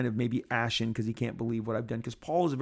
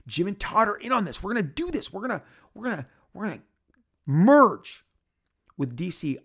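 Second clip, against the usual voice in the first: rising higher and higher until he blurts out merge; reliving the excitement; 7 semitones higher, 4 dB louder than usual. Retold or imitated. Rob telling the podcast how thrilled he was, building to a burst. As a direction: build up like a giddy kid who can't hold it in. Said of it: "getting excited at this prospect".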